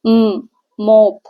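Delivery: म, मोप drawn out very slowly; sounding out slowly. Shown as a demonstration. Teacher stretching words for children, very slow. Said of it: "म sound आ रहा है।".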